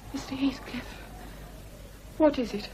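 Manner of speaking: whisper. Acted. Lockwood calling Heathcliff gently, in a whisper. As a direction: whisper his name cautiously.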